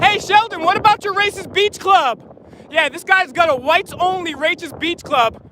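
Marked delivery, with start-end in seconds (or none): 0.0-2.2 high, raised call across a crowd; calling from far away. Real, not acted. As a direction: call out to him from a distance. Protester calling out to a politician.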